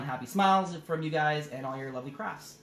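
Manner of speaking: ordinary delivery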